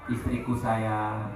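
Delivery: husband sweetly asking wife for coffee, tender; tender sweet talk. Retold or imitated. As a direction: murmur it lovingly, drawn out. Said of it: "ngomongnya lemah lembut".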